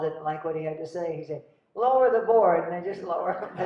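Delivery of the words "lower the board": high, loud mock order; loud command. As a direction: call out like a thwarted director. Director on board calling to crew.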